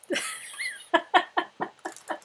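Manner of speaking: high, bright burst of laughter. Real, not acted. Laughing at her own joke.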